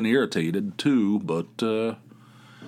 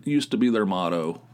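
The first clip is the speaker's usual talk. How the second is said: normal talking voice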